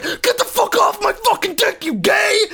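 very high, strained mock scream; hammed-up comic outrage at full pitch; outraged screaming. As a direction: shriek it as if fighting someone off. Acted boss screaming to fight off.

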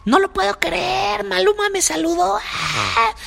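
very high, shrieking fan screams, mock imitation; hammed-up imitation of fans shrieking over their idol; screaming fangirls. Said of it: "Empiezan a gritar".

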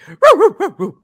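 high, loud dog-like gibberish; Scooby, fast garble. Retold or imitated. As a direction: imitate a cartoon dog rattling off mush. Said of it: "Scooby says it so fast".

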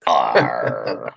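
low gruff pirate arr; pirate growl. Pretend. Pirate letter joke.

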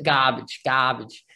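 r-dropped Boston "garbage" said as "gahbage"; Boston accent. Retold or imitated. Host echoing the guest's word in Boston accent. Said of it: "but that Boston accent".

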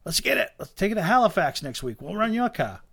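lightly voiced eager buddy; eager friend. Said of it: "He goes".

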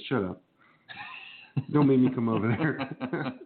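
usual speaking voice

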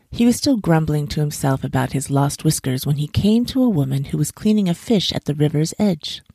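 ordinary delivery, nothing marked

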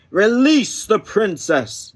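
high, slow forceful demand. Acted. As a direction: demand it like a hero. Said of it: "demanded Gallant".

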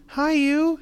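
put-on high, loud child greeting; excited little girl. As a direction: greet her like a thrilled kid.

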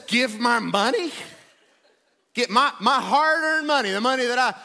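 loud, hammed-up protest over money; indignant complaining. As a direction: complain to Jesus in mock outrage. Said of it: "You ever complained against Jesus?".

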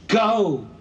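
high desperate cry; pleading shout. Acted. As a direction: scream at him to flee. Mother pleading in vision.

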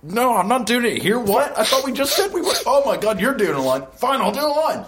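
put-on high, whiny protesting teen; high-pitched whiny voice. Jeremy voiced high, pleading.

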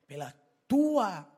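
TUA drawn out and loud; stress on TUA. Real, not acted. Preacher contrasts your own mother with others, TUA stretched loud. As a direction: hit TUA hard, stretching it slowly.

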